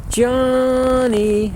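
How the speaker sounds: high held call dropping at the end; falls off at end. Shown as a demonstration. Demonstrating the lost call again, high then fading. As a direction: let the end fall away. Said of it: "and falls off at the end".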